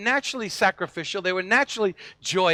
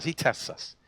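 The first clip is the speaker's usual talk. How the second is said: hushed whisper; 4 semitones lower, 6 dB quieter than usual. Acted. Repeated point, dropped to a hush.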